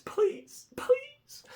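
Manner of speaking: tiny high begging voice; pleading. Acted. Buyer begging the seller about shipping, high pleading. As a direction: beg in a high mock whimper.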